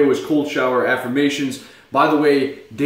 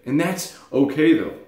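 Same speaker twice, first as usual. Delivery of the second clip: usual speaking voice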